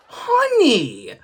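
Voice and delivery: high, loud scandalized "Honey!"; a wife's high shocked holler across the room; indignant yell. Retold or imitated. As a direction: shriek at him in outrage. Grandmother scolds husband on phone.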